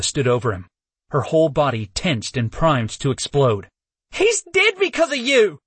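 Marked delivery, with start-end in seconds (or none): 4.1-5.6 high, wailing cry, voice cracking. Acted. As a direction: wail it in grief. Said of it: "she wailed as her voice cracked".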